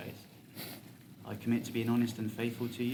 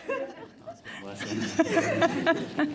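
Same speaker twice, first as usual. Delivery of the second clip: high, slow, laughing through words; 6 dB louder, much slower than usual. Real, not acted. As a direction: drag it out while cracking up. Teasing his Scottish accent attempt.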